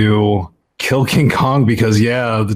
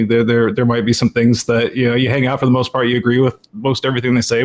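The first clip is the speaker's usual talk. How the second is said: usual speaking voice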